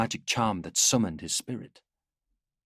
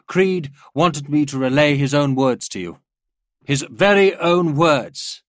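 loud, raised public speaking; rousing address; 5 semitones higher, 7 dB louder than usual. A narrator's reading. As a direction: announce it over a noisy room. General addressing cheering officers.

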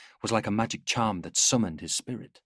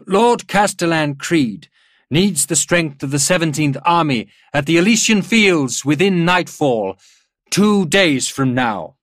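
loud, raised commander's address; public announcement; 7 semitones higher, 9 dB louder than usual. A narrator's reading. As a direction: proclaim the order to all officers. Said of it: "He coughed to clear his voice".

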